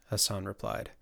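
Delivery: usual speaking voice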